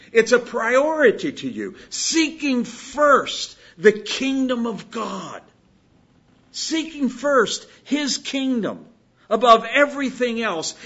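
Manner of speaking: loud, driving sermon climax; preaching at full force. Acted. Preacher builds to loud exhortation.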